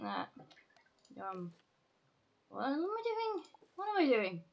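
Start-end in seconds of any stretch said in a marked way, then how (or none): 2.5-4.4 high squeaky pixie voice; light, high little fairy voice. Pretend. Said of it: "I'm talking to fucking Pixie Voice".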